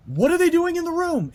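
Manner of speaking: very high, loud, panicked; shrieking in nightmare fear; frantic question. Retold or imitated.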